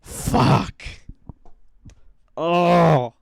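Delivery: loud, gut-punched curse and groan; overwhelmed outburst. Real, not acted. Reviewer floored by Nikki's line to Bear, real loud groan. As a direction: let it burst out, then groan loudly. Overwhelmed reacting to retold scene.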